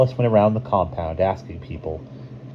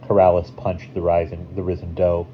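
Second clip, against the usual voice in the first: ordinary delivery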